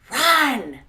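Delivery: drawn-out emphatic cry; exaggerated alarm; urgent warning. Real, not acted. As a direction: call it out long and hard. Urgent warning to leave him.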